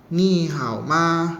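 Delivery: "Ni hao ma." very slow, tones drawn out; slow Mandarin greeting. Shown as a demonstration. Teacher recapping Mandarin greeting for class, very slow. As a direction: model the greeting at a crawl. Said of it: "Nah, seperti itu".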